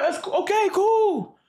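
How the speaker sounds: high-pitched mock acceptance; retold reaction. Patient told by nurses it's natural, high-voiced okay. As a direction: pitch it up, playing along. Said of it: "I'm like".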